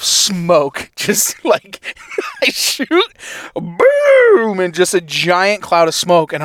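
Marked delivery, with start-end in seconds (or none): 1.8-2.4 sudden high laugh at his own blunder; burst of laughter. Real, not acted. Laughing at the missed shot. 2.4-4.6 mock gunshot. Acting out the shot.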